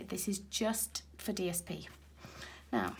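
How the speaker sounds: ordinary talk, nothing marked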